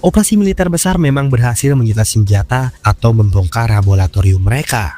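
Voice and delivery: loud, steady documentary voiceover; documentary narration. Acted. Documentary narration over music.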